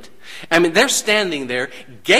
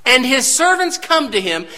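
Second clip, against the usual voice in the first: preaching at full force; 6 semitones higher than usual. Acted. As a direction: build the sermon to a peak. Preaching build, raised voice.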